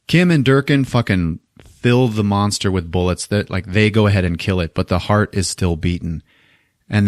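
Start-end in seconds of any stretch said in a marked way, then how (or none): none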